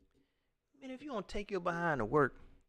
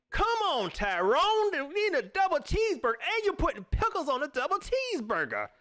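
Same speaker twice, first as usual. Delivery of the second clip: mock scolding; 11 semitones higher than usual. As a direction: fuss at the coworker. Scolding coworker Tyrone.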